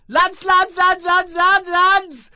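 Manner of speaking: high, loud repeated calling; bursting to tell the lads; frantic high shouting of lads; frantic excited call. Acted. Debbie again.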